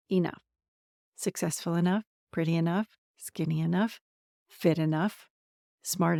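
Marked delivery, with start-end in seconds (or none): none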